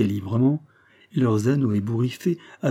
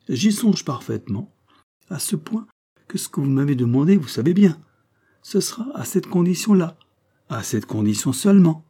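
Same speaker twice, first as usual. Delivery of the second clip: higher-pitched, lightly voiced Rosette; insistent young circus rider; 6 semitones higher, 3 dB louder than usual. Rosette setting her condition for the colonel, insistent. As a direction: press the demand in a raised pitch.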